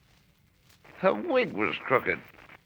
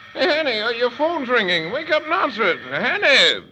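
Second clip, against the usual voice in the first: put-on loud, higher drunken yelling; drunken shouting; 6 semitones higher, 6 dB louder than usual. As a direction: shout up that the phone rings. Drunk shouting phone ringing.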